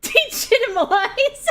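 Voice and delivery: degitimalize, squeaked high through laughter; loud squealing degitimalize; mispronounced word. Real, not acted.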